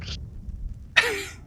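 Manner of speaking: breathy laugh. Real, not acted. Narrator laughs at moment.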